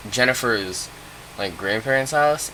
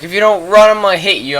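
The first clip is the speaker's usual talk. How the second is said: high, loud mock threat; bossy threat; 9 semitones higher, 7 dB louder, faster than usual. Friend on his back forcing him to run, loud mock threat. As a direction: pitch it up and push it hard.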